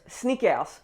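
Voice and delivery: "Snickers" as French "snee-kairs"; French accent. Shown as a demonstration. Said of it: "say them with the French accent".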